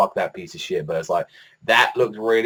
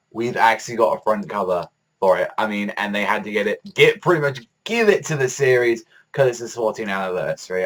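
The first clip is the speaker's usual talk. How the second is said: ordinary talk, nothing marked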